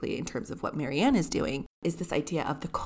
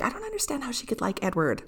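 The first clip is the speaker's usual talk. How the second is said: higher, put-on baffled complaint; baffled Marianne; 7 semitones higher than usual. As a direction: mimic a puzzled sister in a high voice. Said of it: "So she's like".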